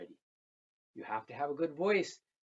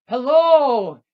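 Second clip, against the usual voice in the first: much higher, much louder greeting; big hello; 12 semitones higher, 21 dB louder than usual. Acted. Girl greets her people, much louder. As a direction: boom it across the village.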